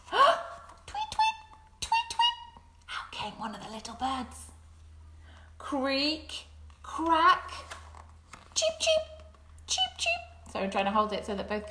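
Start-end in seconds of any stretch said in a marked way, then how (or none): 0.1-2.4 very high tiny chirps; chick tweets. Acted. Newborn chicks hatch from eggs, very high tweets. 5.6-10.2 very high squeaky cheeps; chick cheeps. Acted. Chicks hatch one by one, very high cheeps.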